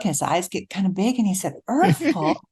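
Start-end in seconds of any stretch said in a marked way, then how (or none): 1.7-2.4 higher, concerned retold question; worried. Husband asking wife who died, worried. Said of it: "extremely worried look".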